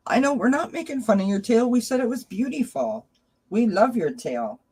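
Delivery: high soothing pet talk. Real, not acted. Owner soothing her cat about its tail. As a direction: reassure the cat sweetly.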